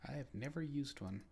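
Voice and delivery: hushed whisper. Real, not acted. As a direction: whisper the question. Whispering question.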